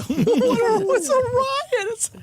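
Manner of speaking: squeaky, stretched-out words through laughter; giggling while praising the show; laughing. Real, not acted.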